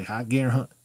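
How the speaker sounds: firm but even command; mean it, without raising your voice. Shown as a demonstration. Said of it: "I'm a little more serious".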